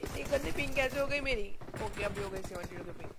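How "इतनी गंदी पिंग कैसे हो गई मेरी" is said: normal talking voice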